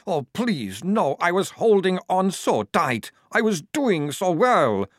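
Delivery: high, loud, desperate plea; anguished pleading. A narrator's reading. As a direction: wail it like someone losing the fight. Vampire losing control in craving.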